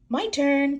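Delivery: put-on high, eager little girl's cry; excited cry. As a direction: cry it out gleefully. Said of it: "cries Peppa".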